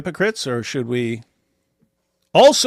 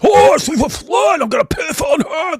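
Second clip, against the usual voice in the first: high, loud mocking shout; mocking Sam; 13 semitones higher, 4 dB louder than usual. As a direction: sneer it out in a shrill mock voice as the ranting opponent. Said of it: "That's how he talks".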